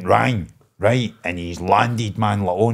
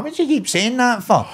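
exasperated; 11 semitones higher than usual. Retold or imitated. Exasperated question to cellmate.

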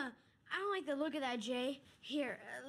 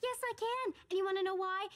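raised, high-pitched retort; defiant; 6 semitones higher, 5 dB louder than usual. Acted. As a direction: fire back at your sister, voice climbing. Sisters quarrel over the speech.

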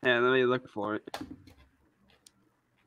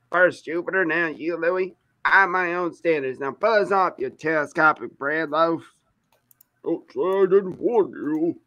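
put-on southern accent; 3 semitones higher than usual. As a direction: redo it in a Southern twang. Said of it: "I had to do that southern accent".

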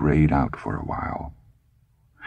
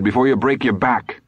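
higher-pitched wifely warning; lightly scolding, pitched up; nagging warning; 7 semitones higher than usual. A narrator's reading. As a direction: warn him as a worried nag. Wife warns husband on ladder.

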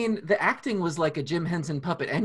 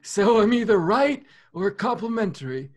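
put-on old person voice; 5 semitones higher, 4 dB louder than usual. Keeps old voice while answering.

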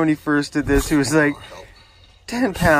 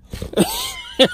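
high squealing laugh; shrieking laugh. Real, not acted. Owner squashed by heavy dog, shrieking laugh.

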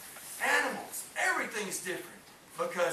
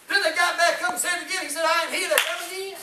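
loud preaching; 9 semitones higher, 6 dB louder, faster than usual. Acted. Preaching at full force.

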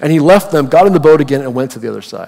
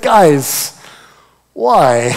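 mock-exasperated plea, pitched up then falling; 4 dB louder than usual. Jesus to disciples fretting about bread, mock exasperation. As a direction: plead in comic disbelief.

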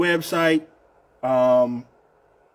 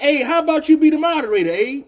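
excited; 12 semitones higher, 6 dB louder than usual. Real, not acted. Excited idea called to Abe.

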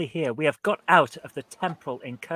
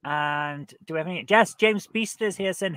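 ordinary delivery

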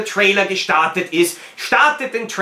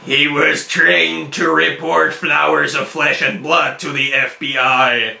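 horror trailer voice; 5 semitones lower than usual. Retold or imitated. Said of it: "Ich liebe diese amerikanische Trailer-Stimme".

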